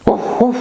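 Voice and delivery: high-pitched little woof; dog bark. Pretend. Teacher showing what dogs do, dog bark. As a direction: yap like a dog.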